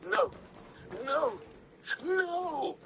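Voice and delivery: rising, fading cries of no; breaking as he refuses it; desperate denial. A narrator's reading. Paul alone in the tent, refusing himself, desperate. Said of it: "pounding the tent floor with his fists".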